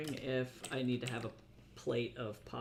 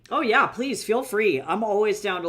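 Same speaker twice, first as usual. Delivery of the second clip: loud eager exclamation; 7 semitones higher, 11 dB louder than usual. Real, not acted. Eager reply to chat suggestion.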